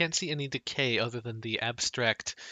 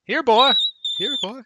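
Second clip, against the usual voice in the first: high mimicked echo of a dog call; 8 semitones higher, 9 dB louder than usual. Game master mimicking a dog call echoing, high-pitched. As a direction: repeat it high, like a bouncing echo. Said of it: "hear the sound echo throughout the building".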